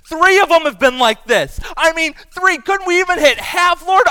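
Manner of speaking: loud, high-pitched complaint to God; worked-up retold prayer of frustration; exasperated plea. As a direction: protest to heaven in disbelief.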